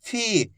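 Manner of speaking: "फी" with a long, held ee; drawn-out vowel. Shown as a demonstration. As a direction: stretch out the vowel of "फी". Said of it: "इस तरीके से आपको इसका उच्चारण करना है".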